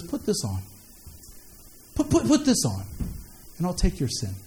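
low, soft and tender offer; soft Jesus voice. Acted. Jesus quietly offering his righteousness to the sinner.

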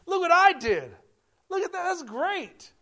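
high, loud whoop then bragging; mock celebration. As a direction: crow over a small win like a kid. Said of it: "and we're like".

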